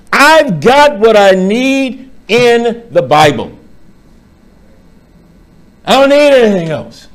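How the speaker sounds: high, loud, insistent sermon delivery; impassioned preaching. Real, not acted. Preacher rejecting apocryphal gospels, impassioned preaching. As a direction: drive each declaration home to the congregation.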